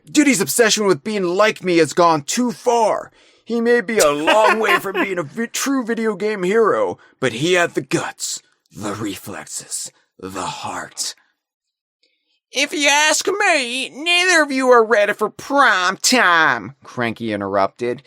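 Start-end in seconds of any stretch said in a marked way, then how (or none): 0.1-11.1 animated storybook read-aloud; story narration. Acted. Reads comic narration aloud. 12.5-16.8 put-on high, slow crotchety old-timer; old prospector. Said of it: "He's just a grizzled old prospector".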